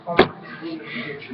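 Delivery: breathy whispered oh my gosh; hushed inner thought; whispered gasp. Retold or imitated. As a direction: whisper it to yourself, stunned. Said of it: "make me think".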